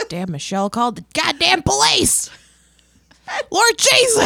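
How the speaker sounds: imitating Eric. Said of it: "He's probably like".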